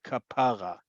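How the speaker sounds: kapara with emphasis on PA, ka-PA-ra; stress on second-to-last syllable. Shown as a demonstration. Teacher contrasting it with Hebrew, kapara stressed on PA. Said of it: "emphasis is on the second to last syllable".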